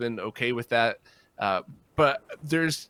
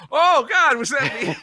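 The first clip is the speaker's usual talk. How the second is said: loud, high cry of embarrassed horror; re-enacted mortification; 12 semitones higher, 8 dB louder, slower than usual. Singer watching his own gig video, mortified. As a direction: recoil aloud at seeing yourself on video.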